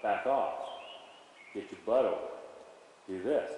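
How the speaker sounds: soft, low, understated commands; quietly telling the horse to back off; quiet cue. Shown as a demonstration. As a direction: give the cue barely above a murmur. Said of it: "very quietly say".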